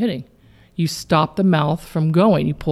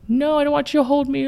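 put-on child refusing; 9 semitones higher, faster than usual. Child voiced in retold scene.

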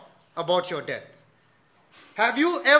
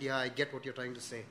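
much softer, low, quick agreement; sheepish reply; 7 semitones lower, 13 dB quieter, faster than usual. Retold or imitated. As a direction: mumble it back, a little sheepish. Friend answers, chastened.

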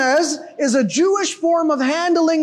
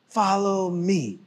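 softer, lower "follow me"; quiet invitation; 7 semitones lower, 6 dB quieter, slower than usual. Retold or imitated. Jesus voiced inviting the crowd, quiet. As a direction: drop low and invite. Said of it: "Jesus cites this".

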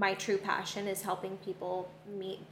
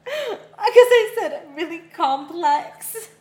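talking through loud laughter; high, laughing explanation; 10 semitones higher, 11 dB louder than usual. Real, not acted. Explains the joke while laughing.

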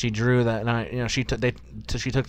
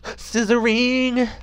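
high, stretched-out gleeful yell; drawn out like a cheer; gleeful shout; 13 semitones higher, 7 dB louder, much slower than usual. Real, not acted.

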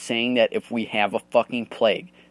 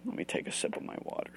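quiet aside; 10 dB quieter, faster than usual. Real, not acted. Aside before drinking.

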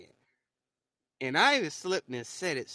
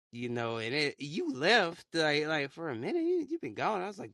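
normal talking voice

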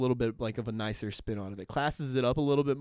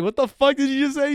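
high, loud, baffled outburst; incredulous mock shout; 12 dB louder than usual. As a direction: yell it in comic disbelief. Acted shocked reply to manager's slang.

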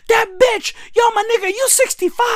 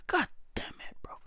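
breathy whispered curse; exasperated whisper; 4 semitones lower, 21 dB quieter than usual. Real, not acted. Whispered curse on hearing his age. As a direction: whisper it in defeat.

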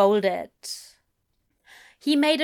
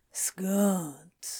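vague, quiet mumble; 5 dB quieter than usual. A narrator's reading. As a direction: say it softly and absently. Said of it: "he said vaguely".

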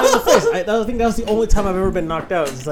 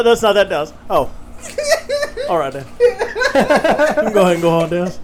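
louder, laughing mock monitor barking; 4 semitones higher, 6 dB louder than usual. Mocking the monitor blaming Dallas for the bus, loud and laughing. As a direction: ham up a pushy monitor. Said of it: "Monitor looked at you like".

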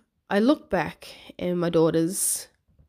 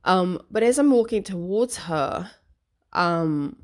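ordinary talk, nothing marked

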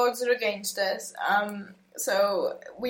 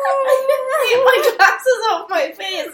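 laughing excitedly; 13 semitones higher, 9 dB louder than usual. Real, not acted. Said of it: "in excitement".

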